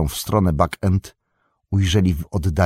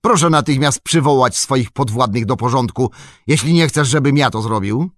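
raised, higher, commanding voice; stern loud order with a threat behind it; sharp command; 6 semitones higher, 4 dB louder than usual. A narrator's reading. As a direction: demand it loudly, brooking no argument. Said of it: "na ostry rozkaz dowódcy".

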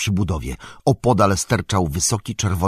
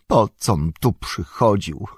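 higher, louder upset wail of regret; distressed lament; 8 semitones higher, 5 dB louder than usual. A narrator's reading. Sam regrets ever wanting to see magic, distressed lament. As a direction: cry out in bitter self-reproach. Sam in despair after the vision.

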